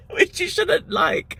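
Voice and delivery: squealing laugh then slow words; real giggling aside; high laugh. Real, not acted. Laughing about a rude word.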